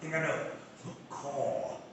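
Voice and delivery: usual speaking voice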